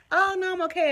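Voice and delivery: turning down the waiter's offer of a drink, in a bright polite voice; polite decline. Retold or imitated. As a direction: brush it off lightly and sweetly.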